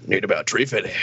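low mock old customer. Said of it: "he's like".

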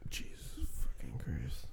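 under the breath, voiceless; hushed exasperated whisper; whispered aside. Real, not acted. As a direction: whisper it in disbelief. Muttered aside at crude joke.

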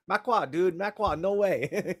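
slow drawn out "ma qua"; drawn-out protest. Retold or imitated. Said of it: "you're like".

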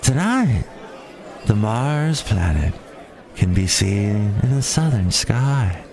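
whispery, slow, low "welcome to Star Talk"; breathy radio host impression. Mocking the Star Talk woman, slow breathy host voice. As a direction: purr it like an overdone radio host. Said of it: "and it's this woman".

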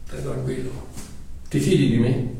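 low, soft and reassuring; calm a frightened friend; gentle Jesus calming Mario; soothing. Acted.

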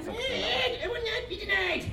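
high, slow, over-the-top villain; cartoon villain voice. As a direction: declare it like a pompous supervillain. Quoting his supervillain cartoon role.

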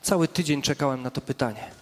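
a quiet, wry "finally"; voicing Jesus, relieved. Jesus answering the praying believer at last, softly relieved. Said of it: "A Jezus stoi obok ciebie i mówi".

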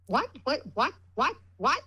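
parrot-like repetition. Retold or imitated. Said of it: "I sounded like a parrot".

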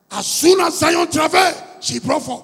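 loud high preaching chant; full force preaching. Acted. Preacher promises the church God will speak, loud and high. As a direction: drive it home to the congregation.

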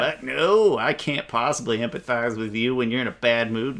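usual speaking voice

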